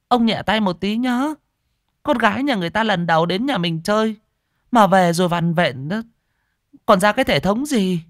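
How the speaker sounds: an anxious mother nagging her husband; fussy old mother. Acted.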